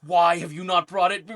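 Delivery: mock upset goddess. Host imitating Meridia mad at being ignored, loud and indignant. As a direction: play an outraged deity scolding the player. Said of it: "super upset about it".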